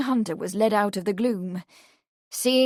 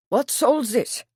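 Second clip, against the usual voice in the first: put-on German accent; 4 semitones higher than usual. A narrator's reading. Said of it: "in a deep guttural voice with a German accent".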